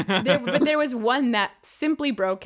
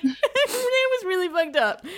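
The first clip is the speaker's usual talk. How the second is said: high, half-laughing, half-crying; laughing through tears; 7 semitones higher than usual. Retold or imitated. Girl to her boyfriend after the maze, laughing through tears. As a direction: hold back tears with a laugh. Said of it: "trying not to cry".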